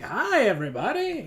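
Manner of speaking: loud, high cartoon greeting; Doctor Nick. Teaching a friend to say hi like a cartoon doctor, Doctor Nick voice. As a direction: sing out an exaggerated cartoon hello. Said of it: "Doctor Nick on The Simpsons".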